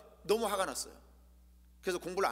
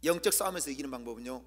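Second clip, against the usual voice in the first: ordinary talk, nothing marked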